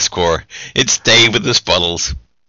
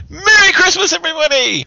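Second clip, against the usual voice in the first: over-the-top greeting. Acted. Exaggerated festive greeting.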